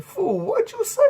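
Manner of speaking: very high-pitched, amused squawk; laughing disbelief. Retold or imitated. Buddy asking his stoned friend to repeat, laughing. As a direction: ask it through imitated laughter, voice shooting up. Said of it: "He starts laughing".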